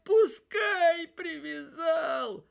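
slow, high sobbing wail with a shout; crying shout. A narrator's reading. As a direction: cry it out through tears, slowly. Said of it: "А он плачет и говорит".

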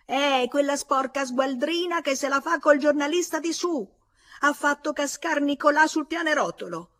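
high, loud, exasperated tirade; shrill exasperation. A narrator's reading. Woman on the stairs denouncing a neighbour, shrill and exasperated. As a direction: rail shrilly at full voice. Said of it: "voce stridula ed esasperata d'una donna".

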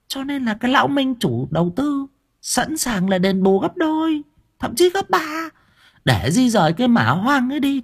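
put-on high, pressed old woman's voice; wife pressing husband to take the money, high and pressing; hissed scheming wife. A narrator's reading. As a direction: urge him on through clenched teeth, pitched high. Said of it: "Bà Cẩm nghiến răng".

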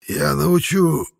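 high, slow, strained reply; distorted by pain. A narrator's reading. Wounded man promises to instruct helper, pained strain. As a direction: push the words out through pain. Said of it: "Голос Эриля от боли исказился".

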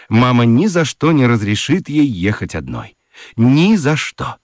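ordinary talk, nothing marked

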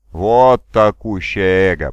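slow, loud, stretched-out cry; drawn-out exclamation. A narrator's reading. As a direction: drag every word out wide. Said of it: "протянул Соломон".

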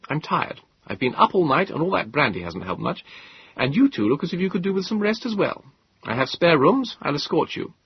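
a touch of wry modesty; young Moses. Acted. Moses voiced by narrator.

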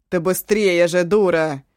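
loud furious command; angry shout. Acted. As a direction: bark at her to hurry. Eshu yells at Eva.

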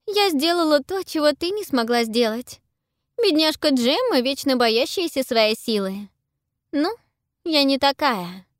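put-on high, scornful girl's voice; proud girl voice. A narrator's reading. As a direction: voice a haughty young girl. Pippa's voice.

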